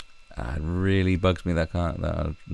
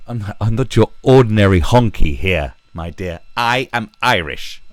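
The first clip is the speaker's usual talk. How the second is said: mock Irish boast; 9 dB louder than usual. Mock quote of an Irish person.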